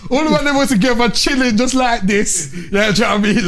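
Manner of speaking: high and loud, words bubbling through laughter; laughing. Real, not acted. Host retelling a room of men, still laughing. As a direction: keep laughing as you push the story on. Laughing after the "pause" joke.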